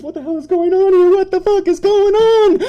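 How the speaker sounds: high, frantic, rushed; losing it at the chaos; panicked disbelief. Acted. Panic at screams outside.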